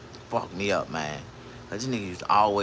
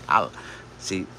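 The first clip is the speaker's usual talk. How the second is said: ordinary delivery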